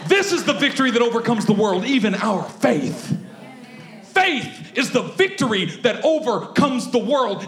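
preacher in full flow; preaching. Real, not acted. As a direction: proclaim it to the church.

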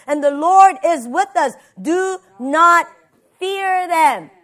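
loud, emphatic Bible reading; preaching scripture reading. Acted. Preacher reading Numbers to the congregation, emphatic. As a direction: punch out key phrases with rising fervour.

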